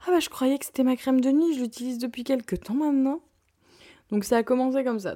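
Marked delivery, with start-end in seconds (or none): 0.1-3.2 lightly voiced mother, higher and louder; mother's voice. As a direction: innocent mother, a bit higher. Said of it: "mother's reply". (voix de maman innocente, un peu plus aiguë)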